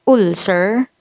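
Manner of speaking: "ulcer" starting with an oo vowel, ool-ser; wrong pronunciation. Shown as a demonstration. Teacher shows what not to say, "ulcer" as ool-ser. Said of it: "you don't say".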